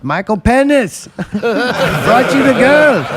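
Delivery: high, loud mock holler; imitated yell. Comic retelling a trailer gag to cohosts, imitated yell. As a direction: yell it out high, cracking up midway. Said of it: "and he yells".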